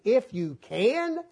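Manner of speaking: slow, drawn-out incredulous echo; Jesus echoing the father's doubt, incredulous; mocking the doubt. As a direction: drag out "If you can" in disbelief. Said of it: "now you ask the question".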